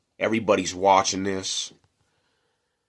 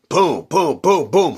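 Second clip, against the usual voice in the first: high, loud punchy booms; mimicked punches; 8 semitones higher, 6 dB louder, slower than usual. Retelling the fight to viewers, loud mimicked punch booms. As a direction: slam each boom out like a blow landing.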